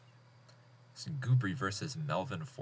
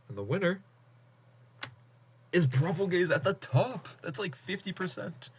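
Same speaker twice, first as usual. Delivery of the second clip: much higher, louder reveal of the winner; excited announcement; 9 semitones higher, 3 dB louder than usual. Real, not acted. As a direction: build up to the winner's name.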